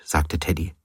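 plain reading voice

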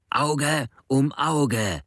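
slow, high, spaced-out cry; shouting. A narrator's reading. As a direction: hammer out each word in fury. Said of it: "schreit er".

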